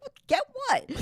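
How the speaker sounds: high, loud baffled "Get what?"; confused squeak, playing dumb. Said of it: "looking at her like".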